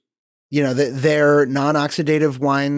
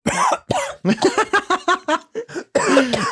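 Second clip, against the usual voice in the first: coughing on inhaled wine; hacking fit after wine went down wrong; choking cough. Real, not acted. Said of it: "you're supposed to swallow it, not breathe it".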